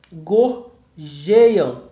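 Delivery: "Gorjeiam" slowly split, GOR then a strong JEI-am; stressed middle syllable. Shown as a demonstration. Teacher showing students the tonic syllable, JEI stressed and lengthened. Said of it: "a sílaba tônica é essa".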